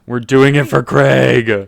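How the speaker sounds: loud, slow, drawn-out declaration; mock rallying cry. Game master echoing a player's dedication to Craig, mock-heroic and loud. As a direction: belt it out like a team motto. Hammed echo of another player's line.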